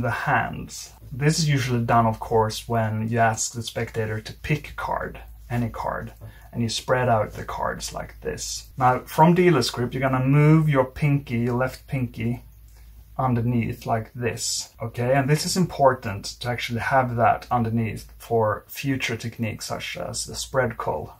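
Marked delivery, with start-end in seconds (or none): none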